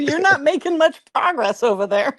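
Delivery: high-pitched, playful chiding; mock scolding parent. Eagle parent to flapping babies, mock chiding. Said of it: "the parents look at 'em like".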